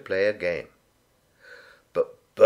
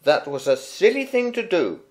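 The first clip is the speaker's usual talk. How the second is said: scolding mum; 10 semitones higher than usual. A narrator's reading. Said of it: "said Bernard's mum".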